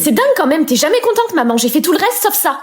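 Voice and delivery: high, shrill fed-up shouting at mum; teen tantrum. Acted. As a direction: snap back at mum. Dispute avec maman. (ado furieuse qui crie sur sa mère)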